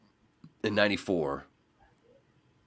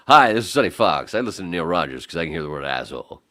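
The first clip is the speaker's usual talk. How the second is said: loud, punchy DJ testimonial; Sonny Fox; 10 dB louder than usual. Retold or imitated. Imitating a radio host's promo for Neil Rogers, DJ impression. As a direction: belt the greeting, then rattle off the endorsement. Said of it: "And then I hear this".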